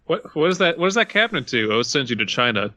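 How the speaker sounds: usual speaking voice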